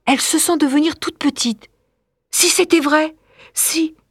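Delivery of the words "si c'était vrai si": higher, alarmed realisation; frightened. Acted. Pupil fears her own story is true, frightened. Lola terribly afraid, thinking.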